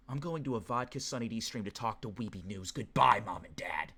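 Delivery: low, soft, serious goodbye; acted solemn farewell. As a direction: play it grave and hushed. Acts out viewer leaving family.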